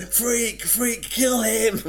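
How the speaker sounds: high, slow, laughing chant; mock mob cry, half laughing; laughing mob jeer. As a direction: jeer it through laughter like an angry crowd. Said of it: "And they all went".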